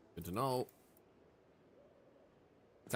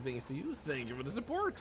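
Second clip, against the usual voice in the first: usual speaking voice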